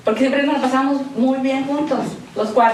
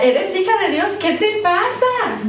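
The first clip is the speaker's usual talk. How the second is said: mock scolding; 7 semitones higher than usual. Scolding a believer who despairs.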